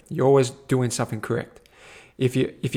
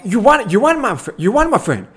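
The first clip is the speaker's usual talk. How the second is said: high, loud triumphant shout; excited cheer; 8 semitones higher, 4 dB louder than usual. Retold or imitated. Congratulating the returning cyclist, high excited shout. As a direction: celebrate his win, full voice. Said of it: "I'm like".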